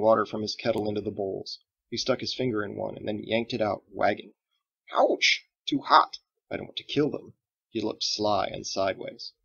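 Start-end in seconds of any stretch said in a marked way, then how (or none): 4.9-7.3 sudden ouch. Acted. Finger in hot water.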